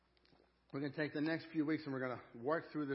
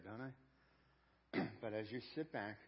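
usual speaking voice

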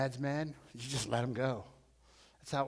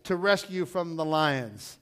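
loud, high anguished call; crying out; 5 semitones higher, 5 dB louder than usual. Retold or imitated. King cries out to Daniel at the tomb, loud and high. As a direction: cry out over the den, pleading. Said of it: "he cries out".

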